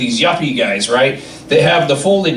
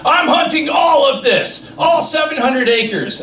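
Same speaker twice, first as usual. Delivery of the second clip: pompous yuppie; 10 semitones higher, slower than usual. Retold or imitated. Mocking a yuppie hunter's boast, high and slow. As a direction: ham up the snooty hunter. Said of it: "and they tell me".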